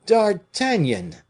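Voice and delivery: long, high, loud joyful call of the name; joyful cry. A narrator's reading. King greets D'Artagnan with joy, a high, loud call. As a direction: greet him with delight, drawn out. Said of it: "cried the king with evident joy".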